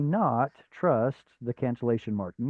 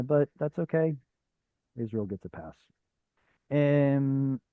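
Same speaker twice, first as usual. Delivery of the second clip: ordinary talk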